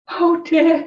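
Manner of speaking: high, loud mock dismay; storybook alarm. A narrator's reading. Owl spots the Gruffalo, high acted dismay.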